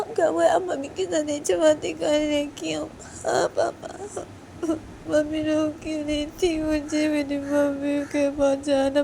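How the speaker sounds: put-on high, tearful little girl begging; high whining crying kid; crying child. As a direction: sob out for mummy.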